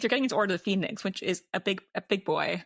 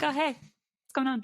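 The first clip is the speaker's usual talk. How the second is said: mock casual Ron; 6 semitones higher, slower than usual. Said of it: "Ron's just like".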